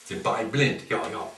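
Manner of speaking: low murmured agreement; low quiet aside. Acted. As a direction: drop it low and soft.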